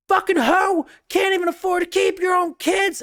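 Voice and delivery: high, shrieking mock outrage; mock furious shout. Imitating the stripper shouting back at her rival, a high mock shriek. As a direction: yell it like an offended stripper. Acted bar quarrel.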